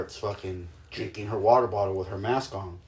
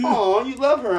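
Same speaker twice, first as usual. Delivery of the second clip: high, louder mock-cooing; teasing aww; 13 semitones higher, 5 dB louder than usual. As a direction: imitate a partner gushing at a softie. Partner teasing him for caring.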